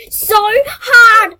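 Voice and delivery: loud tearful yell; real frustrated outburst; rage shout. Real, not acted. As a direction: shout the threat at the game. Threatening to rage at game.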